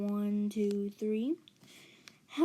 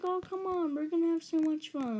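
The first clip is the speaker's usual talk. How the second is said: high, cheery mock coaxing; pitched-up pep; coaxing babysitter; 6 semitones higher than usual. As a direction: imitate a babysitter drumming up fun. Babysitter coaxing reluctant kid, retold.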